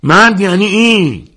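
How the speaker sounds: high, loud, slow cheer; a fan roaring as his boxer enters the ring; shout. A narrator's reading. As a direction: bellow it in proud delight. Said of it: "حد فریاد زد".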